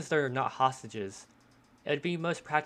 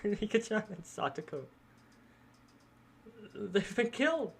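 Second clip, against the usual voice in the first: higher, halting, lightly tearful voice; distressed, tearful character; 7 semitones higher than usual. Acted. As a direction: plead and grieve through mild acted tears.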